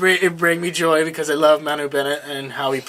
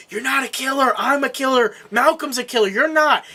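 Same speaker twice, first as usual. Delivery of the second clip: higher, emphatic retold plea; imitated insistence; 7 semitones higher than usual. Retelling brother pleading with sister, higher insistent delivery. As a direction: raise the pitch and insist, point by point.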